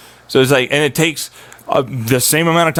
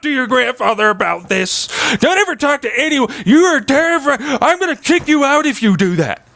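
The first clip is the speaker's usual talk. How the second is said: high mocking imitation of family; high, mocking impression of a threatening relative; 11 semitones higher than usual. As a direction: sneer it like the family did.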